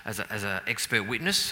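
ordinary talk, nothing marked

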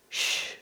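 soft, drawn "shh"; a gentle hush with no voice in it. Acted. Jesus hushing the waters, soft shush. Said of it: "And everything goes quiet".

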